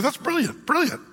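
light up at the idea; a touch of eager glee; excited husband. Retold or imitated. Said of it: "he's like".

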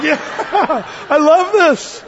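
high, loud, laughing delight; gleeful retold enthusiasm; thrilled reaction. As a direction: crow it with a laugh. Acts his thrilled reaction to organ.

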